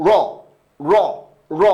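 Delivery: র with a strong, rolled trill. Shown as a demonstration. Teacher showing students a heavily trilled র. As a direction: roll the র so the tongue tip beats hard. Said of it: "তারপরে উচ্চারণ করতে পারেন".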